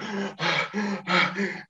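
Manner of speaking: imitated weary puffing breaths; heavy panting. Exhausted swimmer answers a boatman, imitated heavy panting.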